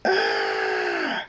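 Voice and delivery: drawn-out scream when struck; scream of pain. A narrator's reading. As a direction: hold a long pained scream. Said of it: "Thunderstorm screams".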